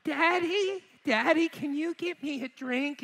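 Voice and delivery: put-on high, slow whiny little boy; little kid voice. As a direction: plead like a small child tugging at dad. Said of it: "He's like".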